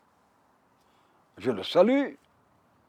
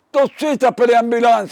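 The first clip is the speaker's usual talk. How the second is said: high, loud urgent command; urgent order; 7 semitones higher, 10 dB louder than usual. Retold or imitated. General ordering the commandant to call an ambulance, loud and high-pitched. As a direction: snap out the order to get help now.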